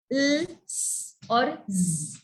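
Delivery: "L", "S", "Z" as held sounds, not letter names; isolated letter sounds. Shown as a demonstration. Teacher recapping the doubling rule, sounds held separately. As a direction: sustain "L", hiss "S", buzz "Z" slowly. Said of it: "hears either of these sound".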